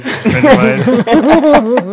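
laughing while telling. Real, not acted.

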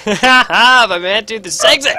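loud laughing, slow and high; laughing shout. Pretend. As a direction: cackle the joke out loud.

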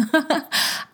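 short high laugh of embarrassment; embarrassed laugh. Real, not acted. Host catching herself tearing up, short high laugh. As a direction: laugh off the tears.

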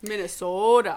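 Minnesota with a drawn-out local O; playful Minnesota accent on the state name. Shown as a demonstration. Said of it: "get my Minnesota accent".

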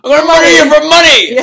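shrieking high cartoon villain; villain shout. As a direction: scream it like a gleeful movie villain. Mock villain shout from the movie.

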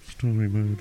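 mocking low voice. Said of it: "You just hear James go".

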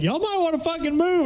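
ordinary talk, nothing marked

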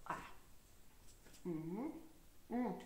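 sounds after a sip of tea; sip, then hum; while sipping a drink. Real, not acted. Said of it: "Ik neem even een slokje water".

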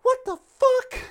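high, loud startled shrieks; high shrieking shout. Acted. Jolene shrieking confused.

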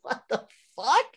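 amused disbelief with a laugh in it; a laughing what the fuck. Retold or imitated. Friend recalling the dragon episode, laughing in disbelief. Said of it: "Like, I was like".

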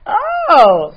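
loud high gasp of shock; scandalized exclamation. Real, not acted. Shocked by joke.

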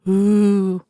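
long drawn-out ghost "ooh"; ghostly voice. A narrator's reading. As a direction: play a spook with a sustained wail. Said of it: "in his ghostliest voice".

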